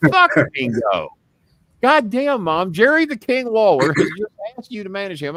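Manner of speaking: loud, high exasperated yell; incredulous yelling son; retold outburst at mom. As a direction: shout it at your mother in disbelief.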